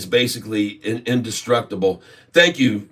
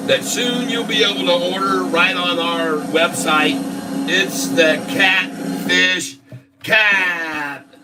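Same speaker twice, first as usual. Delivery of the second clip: put-on grand old ballroom announcer; 3 semitones higher, slower than usual. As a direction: present it like a ballroom host. Announces merchandise with drumroll.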